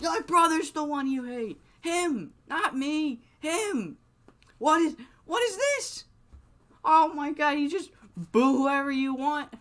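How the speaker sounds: indignant high-pitched far-off shout; mock distant yell. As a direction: pretend to shout across an arena without a microphone. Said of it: "He's yelling this from a distance".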